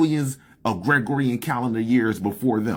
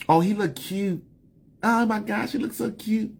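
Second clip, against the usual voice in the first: mock swooning girl; 6 semitones higher, 3 dB quieter than usual. Mocking women admiring a man.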